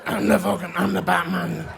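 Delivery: lightly growled Batman voice, half-laughing; a hint of a gravelly ocker Batman; gravelly bogan Batman. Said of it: "That gravelly voice".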